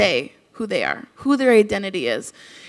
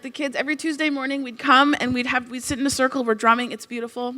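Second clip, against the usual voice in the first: ordinary talk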